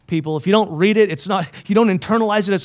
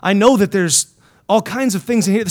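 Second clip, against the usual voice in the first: usual speaking voice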